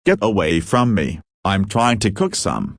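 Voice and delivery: ordinary delivery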